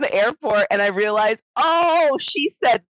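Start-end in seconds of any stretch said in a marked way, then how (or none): 1.5-2.8 amused realization. Retold or imitated. Traveler realizing she misheard the airport lady, amused revelation. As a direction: act out the penny dropping, laughter close behind. Retold realization, breaking into laughter.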